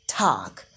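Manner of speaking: "talk" with an open ah, like "tahk"; American "talk". Shown as a demonstration. Teacher contrasting American with British, "talk" with ah. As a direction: drop low on an ah-vowel "talk". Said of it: "in American English as talk".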